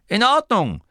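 high, loud go-ahead; Kommandoton. A narrator's reading. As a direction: call the crew to readiness. Inventor gives the go-ahead. (laut und hoch gerufen)

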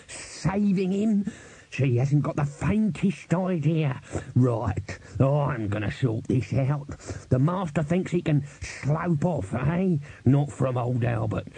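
slow, drawn-out, exasperated character voice; irate old servant. Acted. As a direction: rant like a grumpy old retainer. Albert rants in character.

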